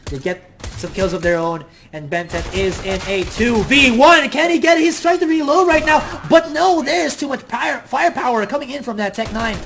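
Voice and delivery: loud, high hype play-by-play; esports caster. Real, not acted. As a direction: call the clutch at full excitement. Live two v one play-by-play.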